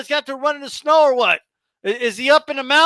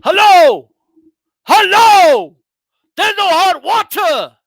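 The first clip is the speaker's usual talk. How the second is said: loud, urgent hello down the phone; mock frantic call to the lobby; 6 semitones higher, 7 dB louder, slower than usual. Frustrated fighter shouting at the hotel front desk. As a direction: play the guest yelling for hot water. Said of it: "he's like".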